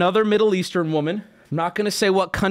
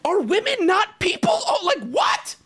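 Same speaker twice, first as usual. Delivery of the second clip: incredulous laugh; 13 semitones higher than usual. Real, not acted. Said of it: "You putting clowns in chat?".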